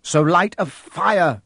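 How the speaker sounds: put-on boy choking out advice. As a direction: force the words through a tight chest. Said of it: "Harry choked".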